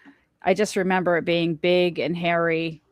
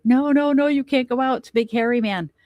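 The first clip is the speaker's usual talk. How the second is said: imitating a scared child; 7 semitones higher than usual. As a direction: voice a frightened little girl holding Mom back. Scared daughter clinging, pleading.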